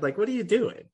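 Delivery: high-pitched indignant quote; mock exasperation. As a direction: imitate a daughter protesting to Dad. Grandmother's retort to dad retold.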